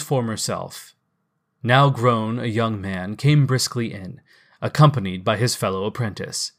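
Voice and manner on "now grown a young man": ordinary delivery, nothing marked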